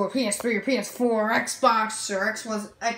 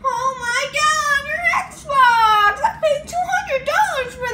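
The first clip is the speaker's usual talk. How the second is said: very high-pitched wailing sobs; crying wail; 11 semitones higher, slower than usual. Acted. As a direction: wail and sob in a squeaky voice. William wails over Xbox.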